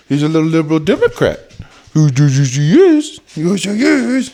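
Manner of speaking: high, fast playful voice; teasing dog voice. Pretend. Joking to listeners about the dog Benji, high teasing voice.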